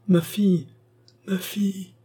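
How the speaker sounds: a high, failing cry that fades; weak cry. A narrator's reading. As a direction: call out to hold them back, feebly. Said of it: "cria le mourant".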